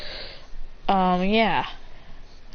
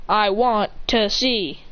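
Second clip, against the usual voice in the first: slow, spaced-out words; deliberate repeat; much slower than usual. Acted. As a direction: say it slowly, each word set apart. Repeats himself after "Did I stutter.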